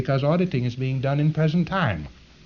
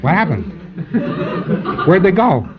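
laughing mock surprise; 8 semitones higher than usual. Lecturer to students, mock bewildered auditor, laughing. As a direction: play the baffled auditor through a laugh.

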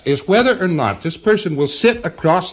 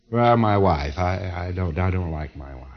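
reluctant patient answer; 7 semitones lower, 5 dB quieter than usual. Retold or imitated. Patient answers the next auditing command.

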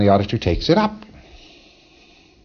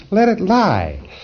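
mock auditor; 11 semitones higher, slower than usual. Mock advice, high.

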